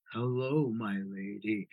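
deep, quiet, sleazy phone-sex greeting; sleazy seducer. Retold or imitated. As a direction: croon it like a cheesy lover on a tape. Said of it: "it was so sleazy".

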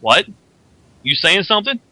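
a hint of confrontational Wolverine; lightly voiced Wolverine. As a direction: imitate a hero challenging someone. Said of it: "Wolverine turns around and is like".

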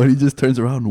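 ordinary delivery

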